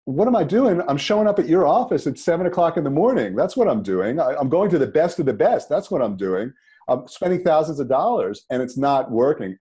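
exasperated, acted-out comeback; indignant retort. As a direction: fire back in self-defence, pitch jumping up.

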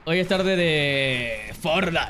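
slow, drawn-out announcement; slow announcer drawl. Acted. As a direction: stretch every word out. Drawn out slogan.

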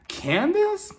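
high-pitched questioning disbelief; baffled, squeaked query; incredulous. Retold or imitated. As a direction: shoot the name way up in pitch. Retold disbelief at yelling claim.

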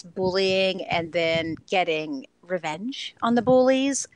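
normal talking voice

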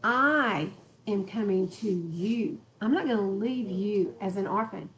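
normal talking voice

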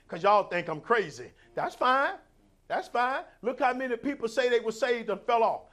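loud, driving sermon cadence; preaching at full force. Acted. Preacher building to full force before the church. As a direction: preach it hard to the congregation.

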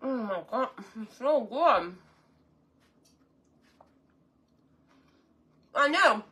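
talking through a mouthful of food; words round a big bite; mouth full. Real, not acted. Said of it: "I'm eating with my mouth all full".

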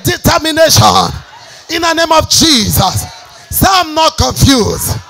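shouted declarations, high and loud; preaching at full force. Real, not acted. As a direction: roar it out to the congregation.

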